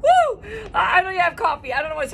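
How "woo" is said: high, loud whoop of joy; a loud happy woo to kick off the show; excited whoop. Real, not acted. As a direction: let out a big cheer. Pumped-up opening cheer.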